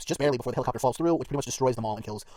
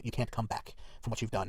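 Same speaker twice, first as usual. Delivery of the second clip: soft, slow, lower imitation of dying words; retold last words; 3 semitones lower, 10 dB quieter, slower than usual. Woman's parting words to Rick, quiet and slow. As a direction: say it slowly and quietly, like a retold warning.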